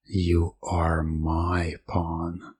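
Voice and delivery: usual speaking voice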